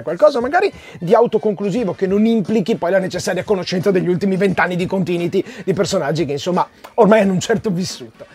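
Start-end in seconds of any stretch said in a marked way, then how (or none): none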